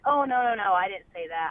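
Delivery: mock backpedalling; retold defensive denial. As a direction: imitate a coach hastily denying it. Coach's denial when confronted, retold.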